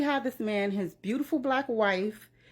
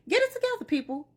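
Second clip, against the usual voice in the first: much higher, exasperated cry; exasperated outcry; 11 semitones higher, faster than usual. Acted. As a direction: throw your hands up and squeal it.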